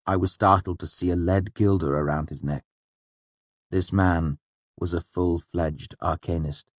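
ordinary talk

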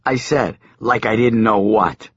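plain reading voice

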